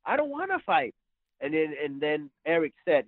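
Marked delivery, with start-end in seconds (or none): 0.0-0.9 high-pitched mock pleading; reluctant. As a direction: imitate a kid begging out of a fight. Retold kid refusing to fight.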